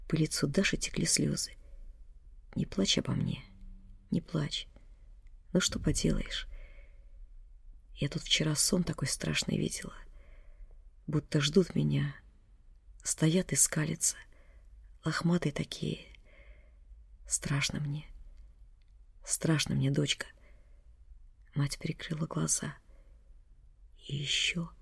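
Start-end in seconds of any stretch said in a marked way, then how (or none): 2.6-20.3 faint, soft, breathy dread; weak dying whisper. A narrator's reading. Dying mother whispers her fear to her daughter. 24.1-24.7 faint, very soft whisper; barely audible whisper. A narrator's reading. Dying mother whispering barely audibly to her daughter. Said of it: "еле слышно прошептала мать".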